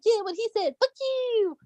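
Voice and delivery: squeaky-high mock defiance, kept small; mock sidekick taunt. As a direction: imitate a little sidekick chiming in. Mock sidekick backing up Ukraine.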